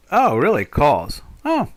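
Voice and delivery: ordinary talk, nothing marked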